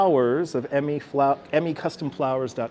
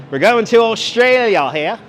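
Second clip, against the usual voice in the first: mock announcer; 3 semitones higher, 5 dB louder than usual. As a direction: present it like a game-show host unveiling a prize. Announcing next country display.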